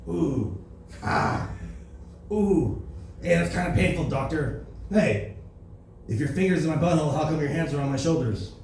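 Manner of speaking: Trump groaning through a prostate exam; pained, indignant Trump; Trump impression. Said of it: "here's Trump getting a prostate exam".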